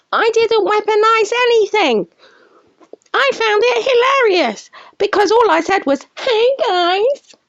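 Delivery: high squealing impression of Nick; a high whiny imitation of the streamer; mocking shriek. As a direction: shriek it back at him, mocking. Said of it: "I'm shrieking now, trying to imitate you".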